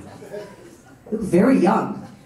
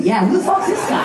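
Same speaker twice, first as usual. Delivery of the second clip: mock shout; 10 semitones higher than usual. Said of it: "Someone said".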